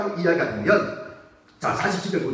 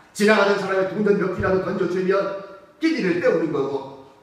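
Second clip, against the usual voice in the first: usual speaking voice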